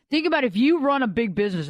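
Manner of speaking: ordinary delivery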